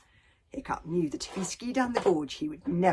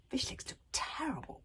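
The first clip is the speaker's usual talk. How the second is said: ordinary delivery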